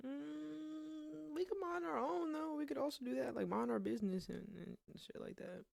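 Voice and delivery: high soft whine. Acted. Viewer begging the ghosts to leave them alone, soft high whine. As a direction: whimper it like a scared kid.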